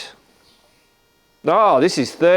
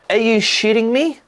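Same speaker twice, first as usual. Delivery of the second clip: incredulous; 10 semitones higher than usual. Real, not acted. Reacting to park fines.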